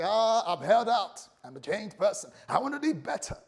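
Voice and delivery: hammed-up, high self-congratulation; acted triumphant relief. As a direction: play someone proudly surviving provocation, pitch jumping up. Acting out proud self-control.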